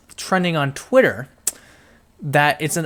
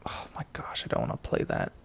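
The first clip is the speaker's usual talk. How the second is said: breathy whisper under the breath; whispered mutter of dismay; whispered aside; 13 dB quieter, faster than usual. Real, not acted. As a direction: drop to a whisper, half to yourself. Muttering aside while reading.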